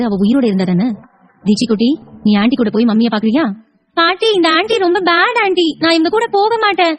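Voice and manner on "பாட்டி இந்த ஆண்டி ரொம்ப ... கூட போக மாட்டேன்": put-on high, slow small-child voice; complaining child. Child tells grandmother she won't go with aunty, high complaining voice. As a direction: protest like a little kid, pitched up high.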